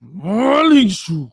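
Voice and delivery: high, very slow "Ma Ling Shu"; drawn-out yell. Shown as a demonstration. Podcaster answering doubts about his Mandarin, long yell. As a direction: stretch the yell across each syllable. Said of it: "start the show by yelling".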